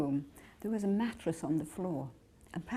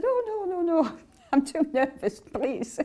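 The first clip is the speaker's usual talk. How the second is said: high, louder, laughing flustered refusal; nervous protest; 9 semitones higher, 6 dB louder than usual. Retold or imitated. Young devotee refusing to meet her guru, flustered laughing. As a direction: giggle out a panicked no. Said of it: "I said".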